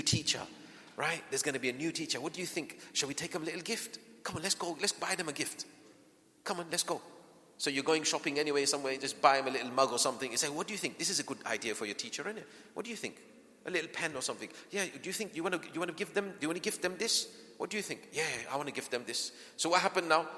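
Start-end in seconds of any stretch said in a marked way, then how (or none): 2.2-7.0 softer, playful coaxing; coaxing parent. Retold or imitated. Parent coaxing child to buy gift. 10.5-18.1 softer, playful coaxing; coaxing parent. Retold or imitated. 18.1-19.3 put-on softer, quick eager child answer; a child agreeing happily; child agreeing to the gift, softer; child's reply.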